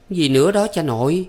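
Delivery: high-pitched, offhand question; leisurely teasing. A narrator's reading. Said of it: "đoạn đủng đỉnh hỏi". (giọng cao, đủng đỉnh hỏi)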